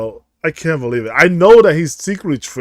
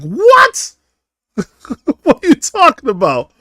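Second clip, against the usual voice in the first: high-pitched, disbelieving "what?"; mock-outraged squawk of surprise; incredulous what; 7 semitones higher, 4 dB louder, slower than usual. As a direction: blurt it out in disbelief. Reacting to absurd imagined assignment.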